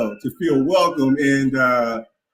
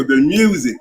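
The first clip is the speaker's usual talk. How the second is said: ordinary delivery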